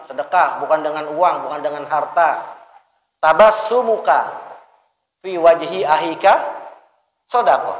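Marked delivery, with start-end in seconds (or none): 3.2-7.7 Arabic hadith recitation. Shown as a demonstration. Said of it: "Senyummu di hadapan saudaramu adalah sedekah".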